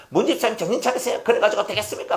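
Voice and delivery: high-pitched pastor's telling-off; mock scolding. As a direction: scold a church member, without real shouting. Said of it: "하면서 막 욕을 막 퍼붓어요".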